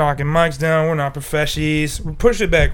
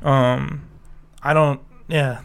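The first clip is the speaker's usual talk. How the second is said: usual speaking voice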